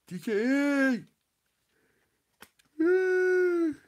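very high, loud whooping cheer; celebratory shout. Real, not acted. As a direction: whoop her name in celebration. Cheering the friend's hundred subs.

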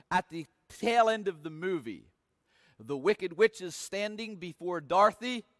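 ordinary talk, nothing marked

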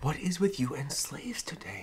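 hushed unvoiced aside; whispering. Real, not acted. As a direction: whisper it across the table.

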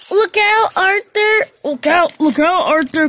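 loud and high, shouting a warning; urgent warning cry. A narrator's reading. Duck warning Arthur of the runaway, loud urgent shout. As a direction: call out fast to save a friend. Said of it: "cried Duck".

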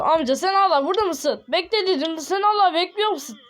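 put-on high, loud exasperated shouting at an old man; annoyed yelling. Gamer yelling at a villager who won't leave, exasperated shout. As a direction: shout him off in mock anger.

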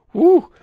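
high, loud relieved whoop; tense "woo". Acted. Player describing tense stealth sneaking, high tense whoop. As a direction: let out a nervous, high whoop.

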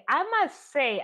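usual speaking voice

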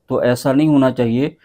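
ordinary delivery

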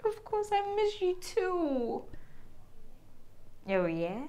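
very soft hushed call; secret phone whisper. Acted. Wife whispering secretly on the phone to a lover. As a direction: keep the call hidden, whisper it.

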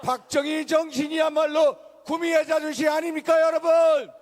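rally cry, rising to a shout; rousing shout. Real, not acted. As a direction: shout it to the crowd. Rally call to crowd.

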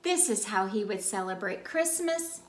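ordinary delivery

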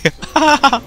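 high peal of laughter. Real, not acted. Player laughing at a death, high laughter.

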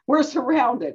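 high, startled gasp of alarm; alarmed cry. Acted. Servant waking to an army, alarmed. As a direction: blurt it out in sudden alarm.

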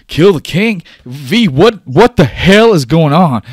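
high, loud outraged disbelief; losing his mind. Acted. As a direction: shout it, appalled and demanding answers. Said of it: "losing his mind right now".

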